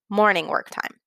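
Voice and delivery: normal talking voice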